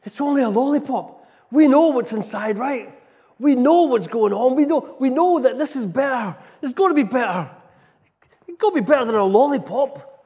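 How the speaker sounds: loud, high, urgent calling out; believers shouting. Retold or imitated. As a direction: shout it from the back to convince him. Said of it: "we're at the back and we're shouting".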